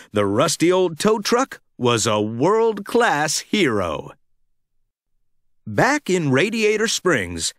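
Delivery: ordinary delivery, nothing marked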